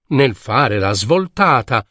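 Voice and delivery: ordinary delivery, nothing marked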